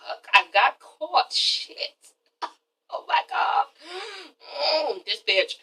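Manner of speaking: whispered, guilty aside; imagined inner thought. Acted. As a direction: mutter it very softly, like being caught out. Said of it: "That's what Kenya was probably thinking".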